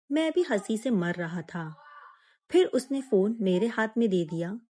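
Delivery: ordinary delivery, nothing marked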